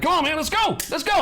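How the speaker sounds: high, urgent rallying cry; hype yelling. Acted. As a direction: yell to fire up a hesitant trader. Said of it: "I'd be yelling".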